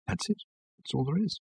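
very soft, deflated murmur; hushed letdown; stunned disbelief. A narrator's reading. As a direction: say it quietly, barely believing it. The question ends absurdly, letters run out.

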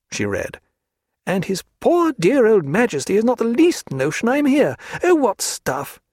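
a spirited lady mocking her hostess; Diana's voice. A narrator's reading.

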